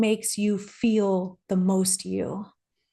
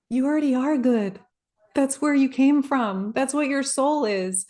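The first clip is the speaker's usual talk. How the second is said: higher, clear mentor voice, lightly voiced; direct, kind mentor; 4 semitones higher than usual. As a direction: speak as a gentle but frank guide. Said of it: "This is how he talks to me".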